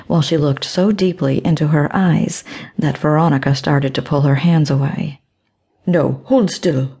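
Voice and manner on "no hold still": sharp. A narrator's reading. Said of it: "she told Veronica sharply".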